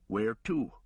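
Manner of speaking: ordinary delivery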